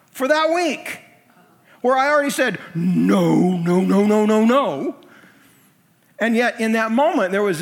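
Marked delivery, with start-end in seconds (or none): 2.7-5.0 louder, insistent repeated no; emphatic no. Retold or imitated. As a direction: refuse it again, hammering each no. Recalling his earlier refusal.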